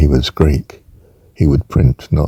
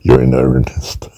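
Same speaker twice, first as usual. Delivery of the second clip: Long John Silver; 5 semitones lower than usual. Pretend. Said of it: "sounds more like Long John Silver".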